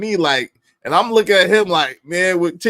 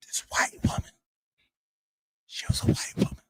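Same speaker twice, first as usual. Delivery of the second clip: hushed, breathy aside to the audience; whispered aside; 11 dB quieter, faster than usual. Pretend. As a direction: lean in and whisper it like a secret. Confiding aside about the woman.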